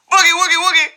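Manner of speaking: high, bouncy boogie-woogie chant; quick high-pitched silly wiggle; playful wiggle noises. Pretend. Host clowning about the elastic mom, playful high chant.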